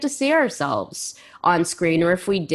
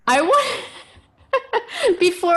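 laughing; 12 semitones higher, much slower than usual. Real, not acted. Host laughs mid-sentence.

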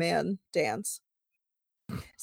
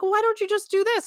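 imitating him, pitched higher; mock know-it-all partner; 12 semitones higher than usual. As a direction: lightly play the helpful fixer. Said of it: "and you'll be like".

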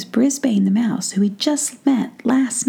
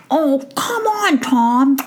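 much higher squeak through a mouthful; high squeaky wheedle; squeaky mouse with mouth full; 6 semitones higher, slower than usual. A narrator's reading. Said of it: "talking with his mouth full".

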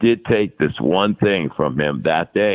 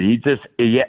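usual speaking voice

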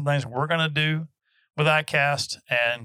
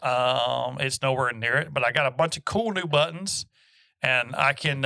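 ordinary talk, nothing marked

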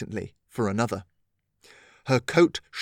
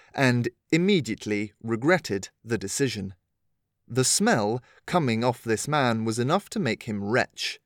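plain reading voice

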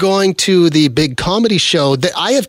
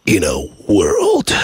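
deep, slow movie trailer voice; drawn-out trailer narrator; 7 semitones lower, slower than usual. Shown as a demonstration. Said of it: "Do I have the movie trailer voice right now?".

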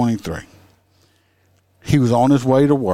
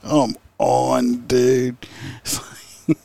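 usual speaking voice

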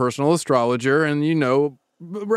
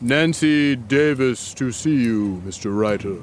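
butler announcing; 6 semitones lower, slower than usual. Acted. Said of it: "The butler announces her".

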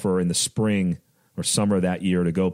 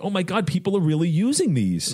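excited; 10 semitones higher than usual. Retold or imitated. Wigged out at seeing his sticker.

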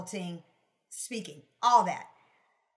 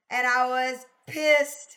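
louder, slow mock fury; mock angry; 4 semitones higher, 9 dB louder, much slower than usual. Real, not acted. Guest admitting to the host she was pissed, mock angry. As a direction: stretch it out, half-laughing at her own anger. Recalling anger, building to laugh.

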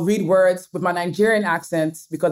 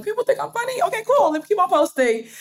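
much higher, delighted inner voice; pitched-up excited self-quote; retold thought; 12 semitones higher than usual. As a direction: replay the thrill of going viral, up high. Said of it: "I'm like".

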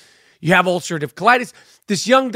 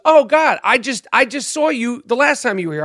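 surprised nurse; 6 semitones higher than usual. Retold or imitated. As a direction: imitate a nurse caught off guard seeing him again. Nurse surprised to see him.

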